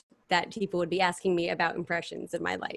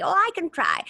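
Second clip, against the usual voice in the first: high put-on old lady voice; 10 semitones higher than usual. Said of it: "who can do an old lady?".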